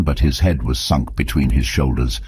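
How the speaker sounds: ordinary delivery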